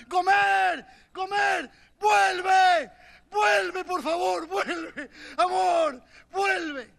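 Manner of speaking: loud high pleading shouts; shouting for her. Retold or imitated. Hosea running through streets shouting for Gomer to return. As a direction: call her back desperately, loud.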